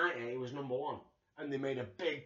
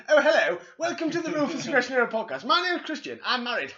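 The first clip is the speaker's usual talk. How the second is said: hammed-up Yorkshire host voice, higher and louder, laughing; mock Yorkshire accent; 7 semitones higher, 8 dB louder than usual. Podcast host mocking his co-host with a hammed-up Yorkshire accent. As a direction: lay on a thick Yorkshire accent, giggling. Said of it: "we sell the fact that we're a Yorkshire podcast".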